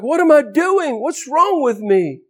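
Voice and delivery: high-pitched retold bewilderment; exasperated self-questioning. Man whose mind is clearing questions himself, exasperated. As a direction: question yourself in dismay.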